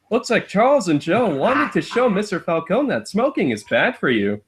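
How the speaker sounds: high sing-song storybook read; children's book narration. Acted. Friend mock-reads the caption in a chirpy sing-song.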